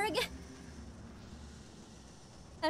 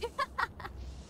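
short high, soft laugh; quick giggle at oneself; amused laugh; 6 semitones higher, 6 dB quieter than usual. Real, not acted. Player laughing at walking away from the friend to save, amused.

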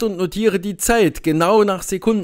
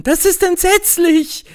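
put-on shrieking girls; 10 semitones higher, 3 dB louder than usual. A narrator's reading. Frightened summer girls shrieking at the eclipse. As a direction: wail it like a frightened girl. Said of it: "kreischten die bunten Mädchen".

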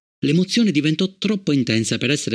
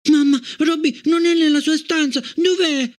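put-on high, worried child's question; a girl suddenly scared; frightened; 12 semitones higher than usual. A narrator's reading. Gloria asking her mother, frightened child voice.

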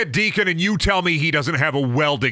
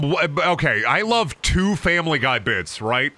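ordinary delivery

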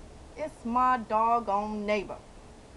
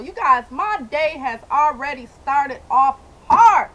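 loud drawn-out complaint; screaming; 8 dB louder, slower than usual. Real, not acted. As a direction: yell it out, slow and loud. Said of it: "I can scream 'cause I'm outside".

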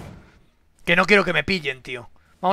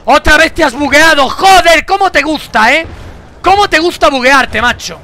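high, loud mocking rant at the bug; angry rant; 8 semitones higher, 9 dB louder than usual. Real, not acted. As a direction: scold the game character, fed up. Ranting at buggy game character. (bronca fuerte y aguda al juego con bugs)

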